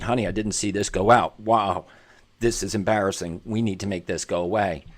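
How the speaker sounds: put-on soft, low, hushed reassurance to his child; gentle talk with daughter. Father gently explains a post to his daughter, softly. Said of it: "I said".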